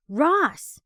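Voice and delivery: loud furious snarl of his name; angry growl. A narrator's reading. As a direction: snarl it in anger. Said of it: "she growls".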